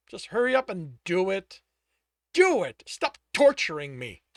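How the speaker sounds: loud, climbing to a cry; anguished shout at his tormentor; desperate outburst. Acted. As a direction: beg to be finished off. Tristan begging for death.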